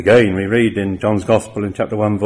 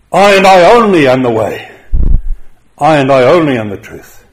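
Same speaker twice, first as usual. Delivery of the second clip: emphatic declaration; 4 semitones higher, 9 dB louder than usual. Retold or imitated. Preacher voices Jesus declaring he alone is the truth, loud and emphatic. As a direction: ring it out, much louder and higher. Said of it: "the Greek is emphatic".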